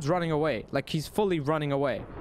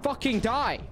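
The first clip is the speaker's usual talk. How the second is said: frantic yell; 11 semitones higher, slower than usual. Real, not acted. Gamer yelling to finish the boss. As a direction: shout it, desperate for the last hit.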